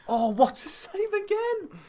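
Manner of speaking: very high, loud, slow exclaim; excited shout. Real, not acted. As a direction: burst out at the save. Reacting mid-pitch to a save.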